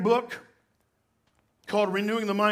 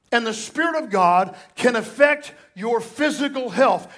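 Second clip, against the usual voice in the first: normal talking voice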